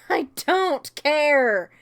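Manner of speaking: slow laughing "I don't care"; amused slow "I don't care"; laughing dismissal. Real, not acted. Repeated with laugh.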